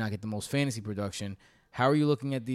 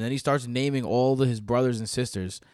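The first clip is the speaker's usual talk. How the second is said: ordinary talk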